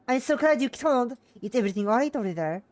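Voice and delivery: high, loud worried mother; mother yelling. Acted. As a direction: fret loudly down the phone. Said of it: "by her familiar voice yelling into my ear".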